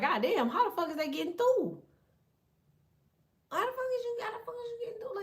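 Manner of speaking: high, squeaky disbelief at the phone; frantic exasperation. Retold or imitated. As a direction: squeal it like your phone betrayed you.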